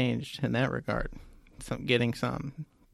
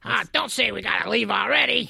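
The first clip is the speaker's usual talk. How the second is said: put-on raspy kid voice; 8 semitones higher, 4 dB louder than usual. Continues kid voice.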